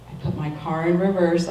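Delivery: usual speaking voice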